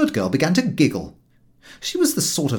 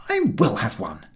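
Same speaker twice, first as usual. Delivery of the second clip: with determination; 4 semitones lower than usual. A narrator's reading. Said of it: "I said it with determination".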